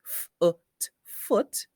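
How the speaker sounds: "foot" broken into f, short vowel, t; short uh sound. Shown as a demonstration. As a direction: sound out "F-, ut", then blend "foot". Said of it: "take the uh sound".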